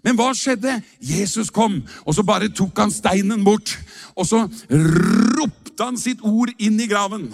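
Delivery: rousing preaching. Acted. Pastor to the congregation, rousing preaching. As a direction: preach it with mounting fire. Rising preaching about Lazarus.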